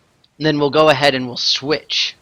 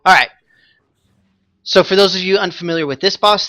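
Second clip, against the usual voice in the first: ordinary talk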